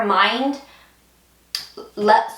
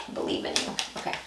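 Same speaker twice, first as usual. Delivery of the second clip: soft whisper to herself; 11 dB quieter, much faster than usual. Real, not acted. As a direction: whisper it under your breath. Coaxing nails to pick up cards.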